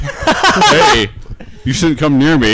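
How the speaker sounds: high, loud laughing cartoon monster; giggly cartoon creature threat; cartoon dam voice. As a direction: voice a silly talking dam threatening a child. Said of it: "was like".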